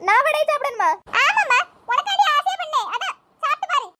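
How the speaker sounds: ordinary talk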